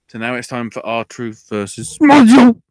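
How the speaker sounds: loud ring-announcer build; wrestling announcer voice; announcer call. Acted. Announcing the match.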